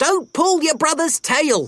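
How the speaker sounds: high, sharp weasel mum telling off; mild telling-off from a tiny mother; scolding Mother Weasel. A narrator's reading.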